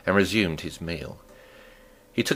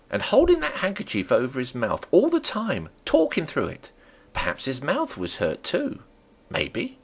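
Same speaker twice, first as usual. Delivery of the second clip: higher, wondering village landlady; a chattering landlady marvelling at her guest; Mrs Hall the landlady; 4 semitones higher than usual. Acted. Landlady gossiping about the stranger, higher wondering voice.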